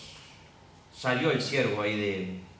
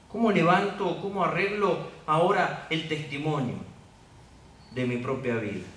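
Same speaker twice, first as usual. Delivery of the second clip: worried believer; 2 semitones higher than usual. Acted. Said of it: "una situación que dices".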